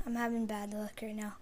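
ordinary delivery

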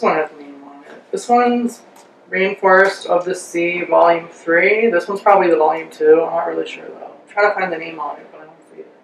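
talking through sheet mask. Real, not acted. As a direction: mouth covered by wet mask.

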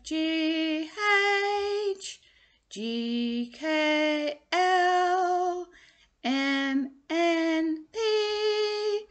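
sliding high up to near-singing letters; playful alphabet. Shown as a demonstration. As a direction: play with the pitch letter by letter. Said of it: "play around with it again".

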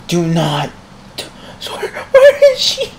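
high squeaky tearful wail; crying with frustration. Real, not acted. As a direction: plead in a squeal, half laughing. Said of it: "I'm crying all at the same time".

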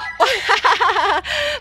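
long, high run of laughter; real high cackling ha-ha-ha; gleeful laugh. Real, not acted. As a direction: laugh hard after scoring. Throws baby in again.

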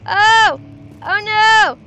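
loud, high, terrified shrieks at the dark; panicked scream. Real, not acted. Streamer panicking in the dark, screaming. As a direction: scream in real panic as the light dies. Startled by darkness in horror game.

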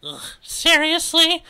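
high, loud, drawn-out disbelief; exasperated teen. Acted. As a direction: groan it out like an eye-rolling teenager. Cordelia acted, rolling her eyes.

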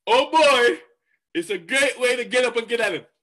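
high, loud and eager; enthusiastic. Retold or imitated. Zig's advice on getting up, said with loud high enthusiasm. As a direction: burst out with morning excitement. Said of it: "enthusiastic clap here and say".

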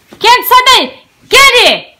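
loud, high call to a dog; high-pitched shouted command; scolding the dog. Real, not acted. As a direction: shout the dog away sharply. Calling the dog away.

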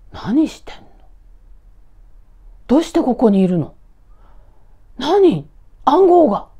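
high, loud startled questions; a mother shocked to find her son home. Acted. Mother startled by her son's sudden appearance, high and loud.

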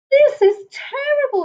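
high wail. Acted. Bush baby after the monster passed, wailing. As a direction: wail it like a little lost creature. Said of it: "he wailed".

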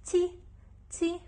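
تي with an "i" vowel, pitched high; high, crisp demonstration of تي; ti with kasra. Shown as a demonstration. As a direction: pronounce تي as an isolated "ti". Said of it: "جواها كسرة حتلفظ".

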